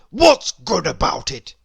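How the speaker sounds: loud, cross grumble; a bad-tempered old grouch barking back; grumpy Mister Grumpy. Grumpy rebuffs Mister Happy.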